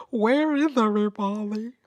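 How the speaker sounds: a touch of hammed-up calling out; lightly mock being left alone; mock plaintive call. Acts out own impatience with lag.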